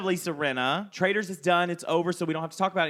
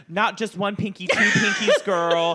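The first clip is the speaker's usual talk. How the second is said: mock sassy voice; 5 semitones higher, 6 dB louder, slower than usual. Imitating friend mockingly.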